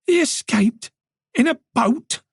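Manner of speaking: high disbelieving questions; can't believe he got away; incredulous. Acted. Tess to Moran about the boat escape, incredulous high pitch.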